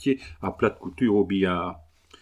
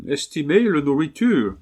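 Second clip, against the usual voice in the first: higher-pitched Englishman speaking broken French; English accent; 5 semitones higher, 3 dB louder than usual. Acted. Englishman haggling over his new dog, English accent. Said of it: "l'accent anglais".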